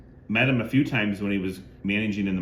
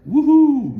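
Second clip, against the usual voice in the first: much higher, much louder whoop; excited woohoo; 12 dB louder than usual. Real, not acted. Collector cheering a blue Sharpie autograph, excited high loud whoop. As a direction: cheer like you hit the jackpot. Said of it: "gonna get a little excited".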